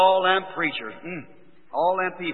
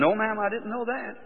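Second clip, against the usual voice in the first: soft, higher retold apology; meek; 5 semitones higher, 8 dB quieter than usual. Son-in-law answers angry mother-in-law, meekly. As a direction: answer small and softly, deferring.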